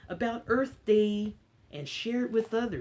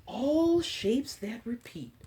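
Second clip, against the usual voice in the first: slow storybook read-aloud; reading to children; 4 semitones higher than usual. Acted. Librarian reading an Earth book to kids, storybook voice. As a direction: read it gently to a class of little ones.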